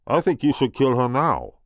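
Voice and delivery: big booming French giant voice; loud, booming French-accented giant; rumbling French accent of a gentle giant. Acted. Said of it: "the big, booming French accent of Andre the Giant".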